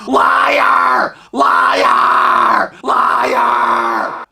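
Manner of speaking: loud, high screech of accusation; mock screaming. Stranger interrupting from behind in the casino, screaming. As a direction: imitate an outraged woman screaming. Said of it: "she starts screaming".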